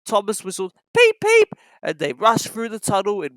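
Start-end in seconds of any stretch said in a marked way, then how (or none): 1.0-1.5 very high loud peeps; a steam whistle toot. A narrator's reading. Thomas whistling on the line, high peeps.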